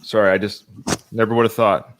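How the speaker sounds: hammed-up overcome by emotion; mock choked up. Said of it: "Sorry, just give me a second".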